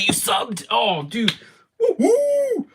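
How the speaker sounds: normal talking voice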